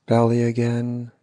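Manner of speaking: usual speaking voice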